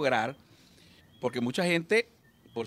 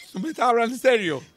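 laughing disbelief; 8 semitones higher than usual. Acted. Drunk man arriving in heaven.

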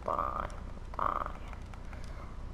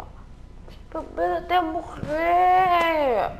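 drinking shout; 9 semitones higher, much slower than usual. Acted. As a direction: bellow the drinking slogan. Shouted repeat of drinking chant.

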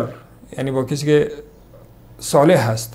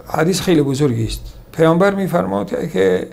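ordinary delivery

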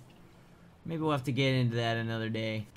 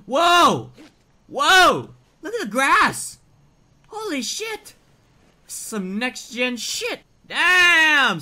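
high-pitched, excited outbursts; amazed exclaiming; 12 semitones higher, 11 dB louder, much slower than usual. Real, not acted. Gamer amazed by next-gen grass, high excited exclaiming. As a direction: exclaim in awe, voice shooting up.

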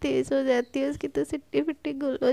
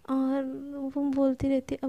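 plain reading voice

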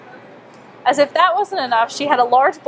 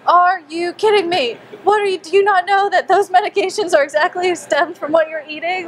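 exasperated inner rant; 6 semitones higher than usual. Retold or imitated. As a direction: rant at her in your head in disbelief. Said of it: "I'm going on and on and on in my mind".

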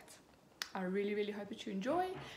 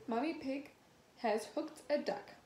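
usual speaking voice

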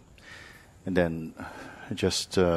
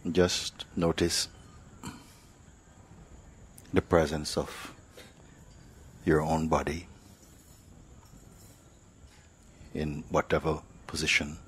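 guided meditation; 4 dB quieter than usual. Acted. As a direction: ease them into stillness, one phrase at a time. Teacher leads silent meditation.

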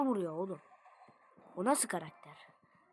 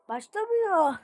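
frustrated whine; 8 semitones higher, 5 dB louder, slower than usual. Real, not acted.

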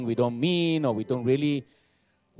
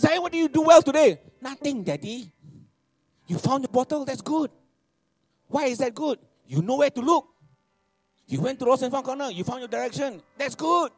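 high, bright cheering coach; high excited praise for son; encouraging dad; 11 semitones higher, 5 dB louder than usual. Retold or imitated. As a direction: cheer the kid on, pitched high.

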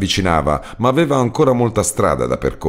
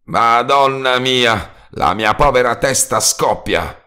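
slow, high cry of pain; shouted groan; 7 semitones higher, slower than usual. A narrator's reading. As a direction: cry out holding your aching head. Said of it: "gridò".

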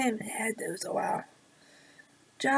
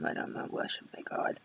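whispered aside; 9 dB quieter, faster than usual. Real, not acted. Relieved aside about being Russian.

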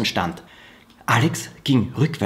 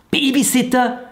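astonished cry; 12 semitones higher than usual. A narrator's reading. Children stunned to hear of a babysitter, high astonished cry. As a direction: shoot the word up in disbelief. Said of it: "riefen Finn, Alex und Mona".

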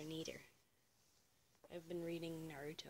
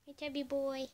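pet talk; 7 semitones higher, 8 dB louder than usual. Real, not acted. Cooing at pet Henry.

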